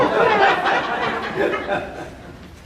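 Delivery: laughing. Acted. Laughing about bad ketchup.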